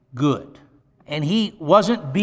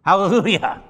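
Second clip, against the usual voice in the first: ordinary delivery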